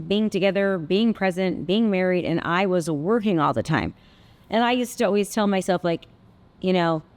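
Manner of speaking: normal talking voice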